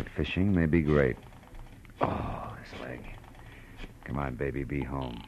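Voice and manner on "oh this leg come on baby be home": low, soft grumble to yourself; muttering to oneself. Acted. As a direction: talk under your breath, waiting on the line. Muttering to himself while phone rings.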